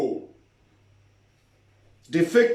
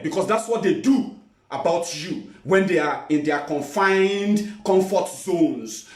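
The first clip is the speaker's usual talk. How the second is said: usual speaking voice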